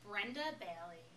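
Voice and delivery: usual speaking voice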